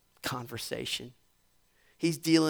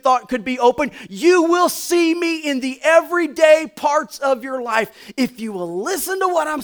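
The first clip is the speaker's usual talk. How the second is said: rising, ever louder, higher preacher's cry; preaching at full force; 7 semitones higher, 12 dB louder than usual. Acted. Pastor crying out God's promise to the congregation, building to a shout.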